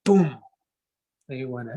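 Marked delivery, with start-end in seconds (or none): 0.0-0.4 vocal drum boom, higher than speech; imitated drum hit. Storyteller imitates uncle hitting the drum in his dream, drum-beat boom. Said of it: "he hit the drum".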